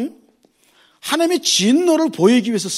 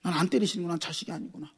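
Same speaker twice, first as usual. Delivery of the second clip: quiet, mild mock lament, much softer; 3 semitones lower, 10 dB quieter than usual. A believer realizes God never disciplines him, in a soft understated lament. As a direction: sigh it out as a forsaken soul. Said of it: "통곡하셔야".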